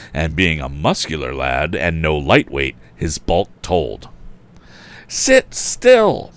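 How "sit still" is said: panting. A narrator's reading. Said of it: "panted our hero".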